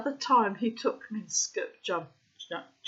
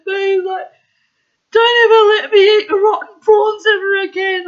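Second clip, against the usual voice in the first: laughing; 12 semitones higher, 16 dB louder than usual. Real, not acted. Laughing while quoting.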